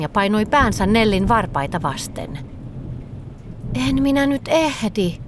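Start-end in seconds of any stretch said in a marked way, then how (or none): none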